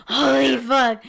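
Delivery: loud, acted shock on waking; startled awake. Podcaster acts out waking from a cliff-fall dream, loud startled outburst. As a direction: blurt it out as if jolted from a nightmare. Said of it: "it wakes us up, and we're just like".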